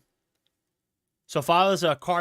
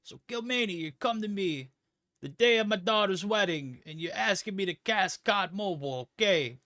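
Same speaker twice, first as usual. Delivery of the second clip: mob boss; 6 semitones higher, 3 dB louder than usual. Acted. Streamer as mob boss addressing chat, high mafia don voice. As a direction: play the Godfather granting a favor. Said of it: "I'm trying to stay in character".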